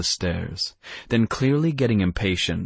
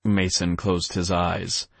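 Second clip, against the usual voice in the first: usual speaking voice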